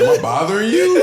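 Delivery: squeaky high mocking voice; mock the kid in a squeak; mocking teen. Parent mocking the teen's attitude, squeaky and high.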